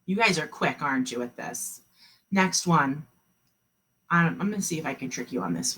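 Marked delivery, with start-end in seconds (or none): none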